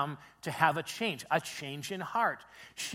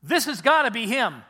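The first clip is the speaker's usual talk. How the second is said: loud, raised, emphatic certainty; convinced declaration; 5 semitones higher, 8 dB louder than usual. Acted. Samaritan woman telling townspeople about Jesus, convinced. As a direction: proclaim it with total conviction. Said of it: "she's basically saying".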